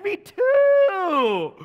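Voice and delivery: shouting with joy at a perfect match; thrilled shout. Retold or imitated. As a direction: cheer it out at the top of your voice. Overjoyed, shouting agreement.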